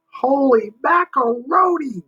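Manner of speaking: high, slow, amazed exclamation; excited cry. Acted. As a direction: cry it out in wonder. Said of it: "Jimmy cried".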